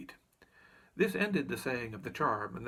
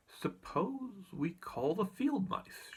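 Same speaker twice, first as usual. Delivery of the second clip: suggesting Dorothy; 7 semitones higher, much slower than usual. A narrator's reading. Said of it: "she suggested".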